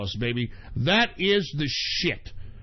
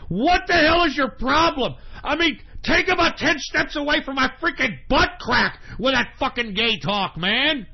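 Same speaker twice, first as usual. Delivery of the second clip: high, loud, shouted tirade; worked-up shouting, punching out the phrases; angry rant; 9 semitones higher, 3 dB louder than usual. Real, not acted. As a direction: yell in outraged exasperation. Angry rant at such dudes.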